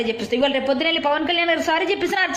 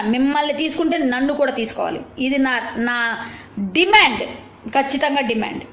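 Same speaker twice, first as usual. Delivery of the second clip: ordinary talk, nothing marked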